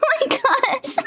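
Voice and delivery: high laughing squeal. Real, not acted. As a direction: crack up helplessly. Laughing fit.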